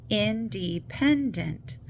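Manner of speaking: "Independent" very slow, in four separate syllables. Shown as a demonstration. Teacher playing with syllables, "Independent" slowly broken apart. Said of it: "So play with syllables".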